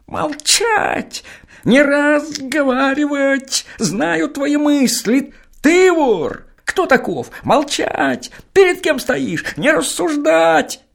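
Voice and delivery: very high, piercing interrogation; shrill shouting gendarme. Acted. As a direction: scream it at him like an angry gendarme. Жандарм снова кричит. (жандарм пронзительно кричит на Серапиона)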